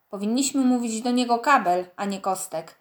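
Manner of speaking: plain reading voice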